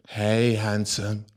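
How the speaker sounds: slow, low, raspy and seductive. Retold or imitated. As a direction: purr it slowly like a flirt. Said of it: "She had that raspy voice ... So seductively".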